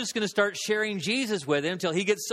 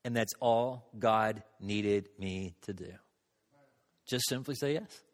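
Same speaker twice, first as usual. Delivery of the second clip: quiet; 8 semitones lower, 6 dB quieter than usual. Real, not acted. Preacher's quiet key point.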